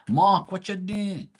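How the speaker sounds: high, drawn-out Bermudian "Mahk" greeting; Bermudian accent. Retold or imitated. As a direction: imitate a local calling him, high and slow. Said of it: "when they say my name".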